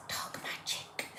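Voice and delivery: slow, breathy mock-sinister whisper; a playful creepy whisper; spooky whisper. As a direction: hush it like a secret spell. Savours the spooky words.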